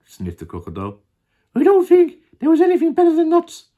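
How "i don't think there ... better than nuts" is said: very high, fast, loud monkey; Mugglewump. Acted. Monkey defending nuts, very high and fast. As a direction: gabble it in a loud squeak. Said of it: "said Mugglewump".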